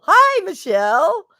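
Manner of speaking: very high, loud, drawn-out hello; excited greeting. Real, not acted. Streamer greeting viewer Michelle, excited high-pitched hello. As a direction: sing out the greeting high and long. Same greeting repeated, exaggerated.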